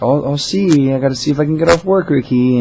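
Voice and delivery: high, loud, friendly stalling; polite put-off. Retold or imitated. Manager dodging a party invitation, polite high voice. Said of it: "trying to be as polite as possible".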